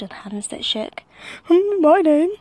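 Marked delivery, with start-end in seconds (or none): 1.4-2.3 high, loud nervous cook voice; hesitant nervous hum; timid cook. A narrator's reading. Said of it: "said the cook".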